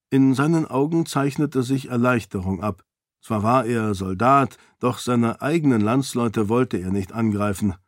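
ordinary delivery, nothing marked

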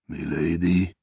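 low, hesitant voice from the dark; deep unsure whisper. A narrator's reading. The seon Ashe finds Sarene in the dark, deep and hesitant. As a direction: ask it low and uncertain, almost afraid to hope. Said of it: "flüsterte eine tiefe, unsichere Stimme".

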